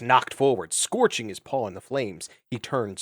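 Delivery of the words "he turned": usual speaking voice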